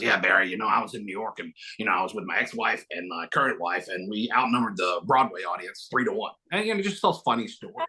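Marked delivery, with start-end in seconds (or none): 0.0-6.3 low-pitched mimicry of an actor's anecdote; lightly voiced Christopher Lloyd. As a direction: drop low like an old storyteller. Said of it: "he goes".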